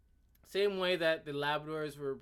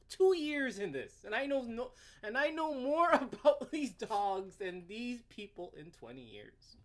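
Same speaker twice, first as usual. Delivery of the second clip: mocking laugh; 5 semitones higher than usual. Repeats the mockery, laughing.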